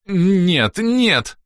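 high frantic refusal; panicked no. A narrator's reading. Fleeing Whisper refusing.

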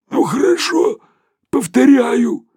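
high, strangled hoarse voice; hoarse and stunned. A narrator's reading. Sharikov, choked by the doctor, agrees in a strangled hoarse voice. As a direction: squeeze it out of a choked throat. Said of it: "сиплым голосом ответил совершенно поражённый Шариков".

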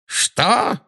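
high sudden cry; dark lord caught off guard; startled exclamation. A narrator's reading. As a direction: jolt in surprise. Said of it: "воскликнул Волан-де-Морт".

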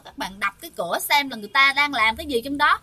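usual speaking voice

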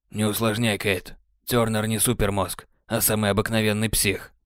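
calm Sam, brushing it off; Sam's voice. Acted. (спокойный, тихий голос Сэма)

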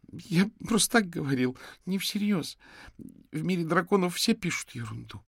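put-on high-pitched child making excuses; high boyish self-justification; defensive boy. A narrator's reading. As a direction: protest innocently as a scared kid. Scared boy excuses his chat threats.

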